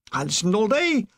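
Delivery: usual speaking voice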